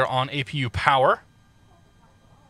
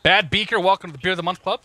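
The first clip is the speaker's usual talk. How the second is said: excited thanks; 5 semitones higher, 3 dB louder, faster than usual. Real, not acted. Thanking a stream subscriber.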